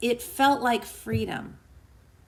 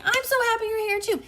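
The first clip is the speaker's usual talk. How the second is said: high, quick, gushing welcome; delighted; 13 semitones higher, 3 dB louder, faster than usual. Real, not acted. Host welcoming a viewer who joined, delighted. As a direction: gush it happily, pitched way up. Answering the viewer's happy comment.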